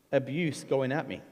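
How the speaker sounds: ordinary talk, nothing marked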